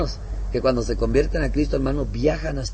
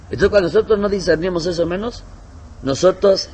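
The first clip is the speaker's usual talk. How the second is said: preaching fervor; 6 semitones higher, 5 dB louder than usual. Real, not acted. Preaching at full force.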